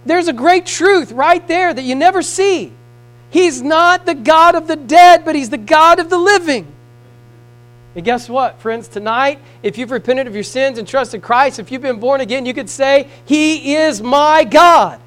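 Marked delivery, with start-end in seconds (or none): 0.1-6.7 high, loud, slow preacher's cry; preaching shout. Acted. Preacher quoting Jesus to congregation, loud high proclamation. 13.2-15.0 slow, loud, high declaration; declaring. Acted. Preacher leading believers to claim God, slow loud declaration.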